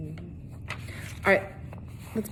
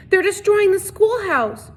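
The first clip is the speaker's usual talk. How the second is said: high, loud, slow gasping plea; breathless panic; 12 semitones higher, 5 dB louder, slower than usual. A narrator's reading. Catherine bursting in on the sheriff, breathless and frightened. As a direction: gasp it out, scared and out of breath. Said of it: "gasping for breath".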